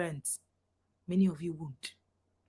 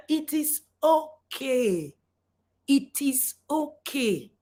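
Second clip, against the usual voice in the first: slow, higher, stretched words; drawn-out concession; 4 semitones higher, much slower than usual. Real, not acted. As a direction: concede pointedly, stretching each word. Mock concession to refusing parents.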